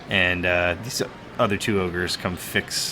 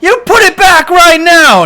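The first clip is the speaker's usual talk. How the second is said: high, loud furious demand; angry shout; 17 dB louder than usual. Acted. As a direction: yell the order at the ogre. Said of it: "and he's just like".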